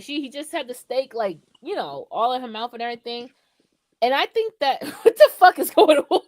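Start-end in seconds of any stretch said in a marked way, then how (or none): 4.8-6.2 laughing. Real, not acted. Laughing at the photo.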